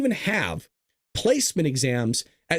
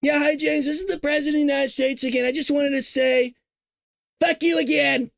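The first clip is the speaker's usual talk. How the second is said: high, cocky mock president repeating the insult; gleeful prank call as Trump; Trump impression; 8 semitones higher than usual. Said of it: "you know what he's gonna do?".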